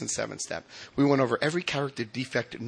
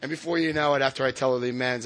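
ordinary talk

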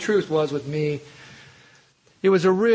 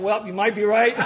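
smugly placating; 4 semitones higher than usual. Retold or imitated. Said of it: "I said".

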